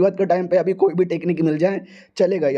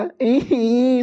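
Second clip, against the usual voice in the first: teasing laugh; 5 semitones higher than usual. Acted.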